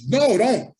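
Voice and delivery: loud, high-pitched warning to hold back; urgent retold command. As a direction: call someone off urgently. Acted order to stop a friend.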